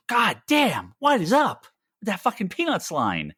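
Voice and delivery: high, incredulous outburst; exasperated. Real, not acted. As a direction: throw your hands up in disbelief. Exasperated outburst about line.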